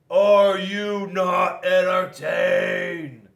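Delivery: loud, very slow arena challenge; gladiator roar. A narrator's reading. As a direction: roar to the crowd. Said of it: "looked around at all the spectators and said".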